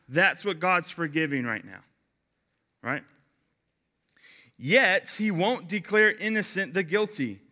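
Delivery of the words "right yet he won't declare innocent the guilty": ordinary talk, nothing marked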